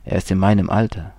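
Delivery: plain reading voice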